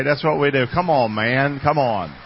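ordinary talk, nothing marked

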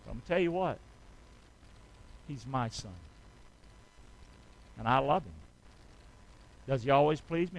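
ordinary delivery

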